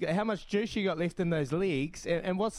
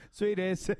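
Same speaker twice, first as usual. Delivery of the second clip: ordinary delivery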